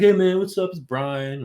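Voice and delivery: high, loud friendly greeting; bright hammed-up hello; cheery delivery guy. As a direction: greet like a chummy driver. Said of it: "he's like".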